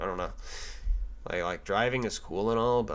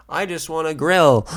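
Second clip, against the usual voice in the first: louder, higher exasperated cry; exasperated outburst; 6 semitones higher, 11 dB louder than usual. Retold or imitated. Pleading with the clerk to just let him buy, loud exasperated cry. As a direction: whine it out, getting loud. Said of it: "And I'm just like".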